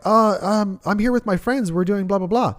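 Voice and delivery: put-on high, hesitant girly voice; flustered girl. Imitating the club girl fumbling to explain, high and flustered. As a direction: stammer like a caught-off-guard girl.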